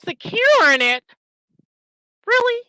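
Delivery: high, loud disbelieving echo; outraged disbelief, pitch way up; incredulous. Real, not acted. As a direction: throw the word back in disbelief. Incredulous at rival's claim.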